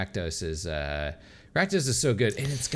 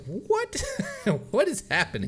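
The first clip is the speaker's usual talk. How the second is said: surprised. Retold or imitated. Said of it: "was like".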